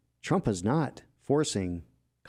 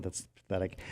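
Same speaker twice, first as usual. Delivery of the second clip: normal talking voice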